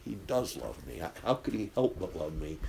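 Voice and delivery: low, soft, tearful plea; a retold sob of relief, hushed and low; quiet weeping disbelief. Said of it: "And she began to weep".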